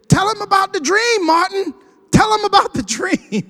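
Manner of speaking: high, urgent call from the side. Retold or imitated. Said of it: "[name] tried to save him".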